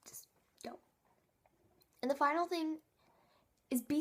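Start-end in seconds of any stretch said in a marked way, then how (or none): 0.1-0.8 breathy whisper, an aside to the viewer. Real, not acted. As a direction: drop to a whisper. Tip given as sly aside.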